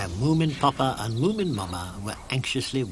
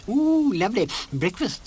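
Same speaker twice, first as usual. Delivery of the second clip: high, eager little creature's voice, a touch louder; Sniff's delighted voice; 7 semitones higher, 3 dB louder than usual. Acted. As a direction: gush over breakfast like a greedy small creature. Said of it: "said Sniff".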